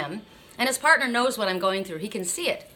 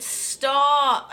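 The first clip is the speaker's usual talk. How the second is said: higher, drawn-out plea to stop; retold pained cry; 5 semitones higher, 4 dB louder than usual. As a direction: re-enact crying out in the dentist's chair. Retold plea during excruciating pain.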